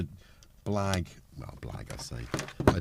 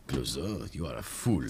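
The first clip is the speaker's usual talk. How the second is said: lower-pitched impression of the inspector's boss; film chief; 6 semitones lower than usual. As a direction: do the exasperated police chief, lower and measured. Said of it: "That's the same tone".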